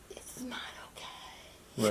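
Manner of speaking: hushed whispered "It's not okay", slow. Real, not acted. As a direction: breathe it out as a pained whisper. Repeated moral refrain, whispered.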